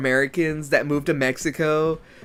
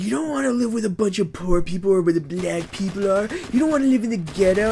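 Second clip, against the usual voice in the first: mock internet voice; 5 semitones higher, faster than usual. Mocking internet people quote.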